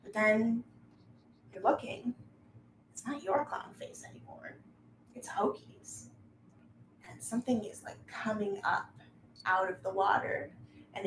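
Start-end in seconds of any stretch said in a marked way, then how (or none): none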